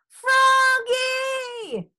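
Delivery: high, drawn-out calling yell. A narrator's reading. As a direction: holler the name across the field. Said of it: "yelled Frogolina".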